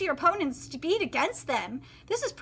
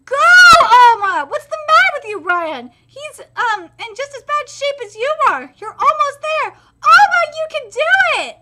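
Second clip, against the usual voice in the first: high, loud, laughing and crying; root for him with everything; tearful cheering; 8 semitones higher, 11 dB louder than usual. Real, not acted. Fan screaming encouragement at Oma.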